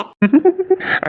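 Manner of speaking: a high burst of laughter at her threat; teasing laugh. Acted. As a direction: laugh off her mock anger. Husband laughs at wife's mock threat.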